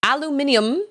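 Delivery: aluminium said the British way with an extra syllable; British pronunciation. Shown as a demonstration. Streamer lists British words, aluminium as "al-yoo-MIN-ee-um". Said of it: "When you're speaking British".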